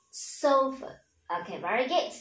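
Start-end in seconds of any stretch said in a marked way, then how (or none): 0.1-0.9 sofa with a weak short "ơ" ending; correct English pronunciation. Shown as a demonstration. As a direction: say sofa English-style, stress first, tail reduced. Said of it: "mà chúng ta sẽ đọc là gì". (sofa chuẩn Anh, đuôi "ơ" ngắn)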